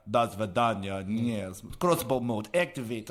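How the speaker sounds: put-on Russian accent; switched Russian-style accent. Rogue player changing disguise next scene, mock Russian accent. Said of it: "I could just be like".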